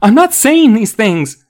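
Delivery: high, loud, slow protest; flustered denial. A narrator's reading. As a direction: plead innocence in panic. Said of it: "Chris stammered".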